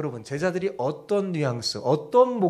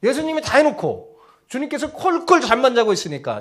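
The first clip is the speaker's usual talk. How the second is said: normal talking voice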